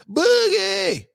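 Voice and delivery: high, loud call of the name. Real, not acted. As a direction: sing out Boogie to kick off the segment. Calling out segment start.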